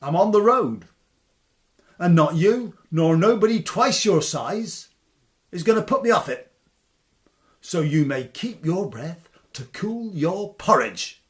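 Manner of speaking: loud, cocky, rushed defiance; Tom's defiant retort. Acted. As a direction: shout back at the giant without fear. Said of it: "says Tom".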